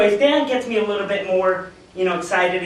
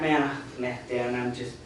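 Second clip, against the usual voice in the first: much softer, lower and defeated; deflated; 7 semitones lower, 8 dB quieter than usual. Retold or imitated. Calling Dan back after recruit vanished, dejected and soft. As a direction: let the air go out of you. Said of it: "Call Dan back".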